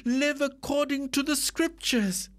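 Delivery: high, slowed, indignant insistence; indignant wife. Acted.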